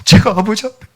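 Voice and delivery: put-on child sobbing an apology to his father; tearful confession. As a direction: apologise through tears. Child confessing while crying.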